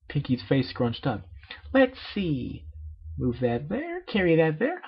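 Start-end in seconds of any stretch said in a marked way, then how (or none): 1.7-4.8 very high, bubbly cartoon pony; Pinkie Pie voice. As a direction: squeak it out like a hyper party pony.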